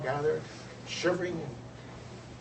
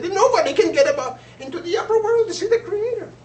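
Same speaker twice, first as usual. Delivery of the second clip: much higher and louder wailing bird voices; despairing birds; 14 dB louder than usual. Acted. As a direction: cry out in hopeless despair like freezing birds.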